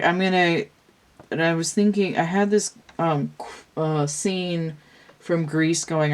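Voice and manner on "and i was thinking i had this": normal talking voice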